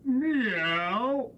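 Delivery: long sung meow; cat meow. Pretend. Child meows for the singing cat. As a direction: stretch it out like a cat. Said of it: "And the cat sang".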